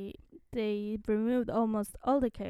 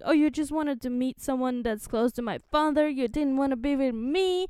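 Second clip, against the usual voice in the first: higher, loud mock-indignant whine; mad teen outburst; 4 semitones higher, 7 dB louder than usual. Ember to friend who met her father's double, mad. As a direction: act out a jealous, hurt daughter throwing a fit. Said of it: "Ember is so mad".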